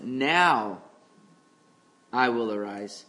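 "Now", a pause, then "I will arise" low and loud, like God himself; voice of the Lord. Shown as a demonstration. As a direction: proclaim "Now I will arise" as God's own declaration. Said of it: "More like".

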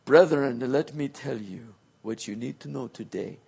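a parody of a grand King James orator; mock King James preacher. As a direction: put on a pompous old-fashioned pulpit tone. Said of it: "speaking in this kind of eloquent kind of voice".

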